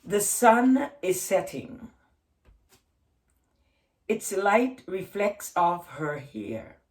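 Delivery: slow, measured haiku reading with long pauses; poem reading. Acted. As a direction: let each image settle before the next. Reads her haiku aloud.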